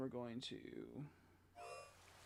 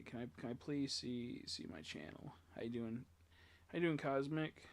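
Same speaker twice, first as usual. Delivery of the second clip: ordinary delivery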